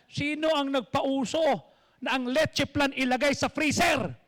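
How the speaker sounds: loud, indignant yell about the leche flan; furious relative shouting about dessert; angry shout. Retold or imitated. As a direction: yell at the family across the table.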